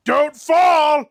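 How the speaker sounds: sudden loud, high warning yell; prank shout. Retold or imitated. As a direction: startle them with a mock alarm. Like a little prank.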